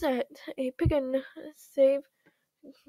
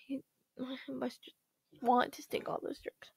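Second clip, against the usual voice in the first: voiceless whisper; 2 semitones lower, 10 dB quieter than usual. Acted. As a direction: whisper it conspiratorially. Confiding in low voice.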